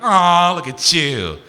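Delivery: put-on high, loud baby-talk teasing; mock cooing. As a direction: coo as if humouring an angry toddler. Cooing at someone cutely angry.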